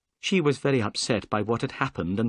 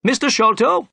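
high, loud formal address; officious inspector; 5 dB louder than usual. A narrator's reading. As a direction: summon the suspect with authority. Inspector making an arrest.

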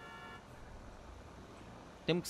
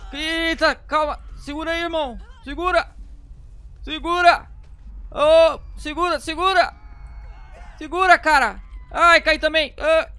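higher, louder frantic urging; 7 semitones higher, 5 dB louder than usual. Real, not acted. Gamer yelling at his friend to hold on, frantic. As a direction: shout hold on as everyone falls. Friends falling during quake.

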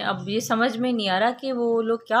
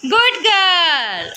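drawn-out praise; 9 semitones higher, 5 dB louder, much slower than usual. Real, not acted. Praising daughter for picking up copy.

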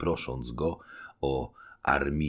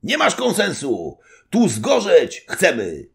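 much higher, louder defiant cry; crowd shouting; 10 dB louder than usual. A narrator's reading. Officers shout down the general, defiant cry. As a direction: shout it as many voices. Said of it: "zawołało kilkanaście głosów".

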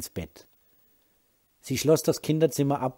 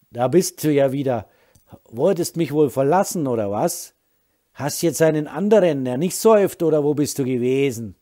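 put-on loud, suspicious drunk husband, higher and louder; drunken accusation; 3 semitones higher, 9 dB louder than usual. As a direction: hurl jealous accusations, slurring a little. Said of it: "Sein Ton machte Laura Angst". (betrunken, laut und misstrauisch vorwerfend)

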